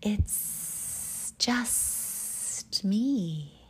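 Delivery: very slow, drawn-out hissed S sounds; hissing snake. Acted. Snake revealing himself to Albie, slow hissing voice. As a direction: stretch every S like a snake.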